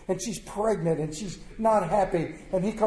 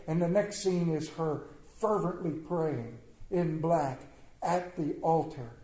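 ordinary delivery

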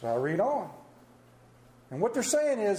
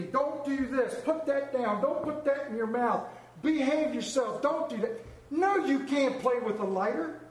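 put-on raised-pitch fatherly scolding of small boys; higher-pitched dad laying down safety rules; stern dad lecturing; 6 semitones higher than usual. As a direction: rattle off the rules like a dad at his kids.

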